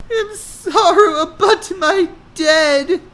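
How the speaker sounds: put-on high, slow, tearful girl voice; crying apology. A narrator's reading. Hannah apologizing to Kung Fu Panda, crying. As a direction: apologize through tears. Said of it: "Hannah cried".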